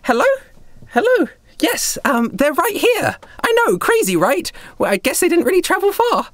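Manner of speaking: high-pitched, peppy phone chatter; chirpy girl on a call. Acted. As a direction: chat perkily into a communicator as a young girl. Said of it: "some sort of communication device".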